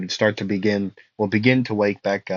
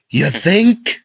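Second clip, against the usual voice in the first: higher, louder put-on voice, tried once; a first rough go at the sarcastic voice; first try at Zora voice; 6 semitones higher, 6 dB louder than usual. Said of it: "And Zerks would say".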